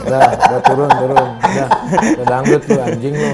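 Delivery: put-on drunk heckler. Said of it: "dia bisik-bisik di bawah".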